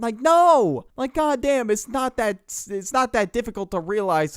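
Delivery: loud, high fed-up protest; real exasperation at gullible classmates; exasperated outburst. Real, not acted. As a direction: let the frustration burst out.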